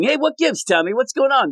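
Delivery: high-pitched mock shouting; imitated yelling. Teens yelling at Tommy for answers, high mock yell. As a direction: call out in mild mock alarm. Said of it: "they're yelling".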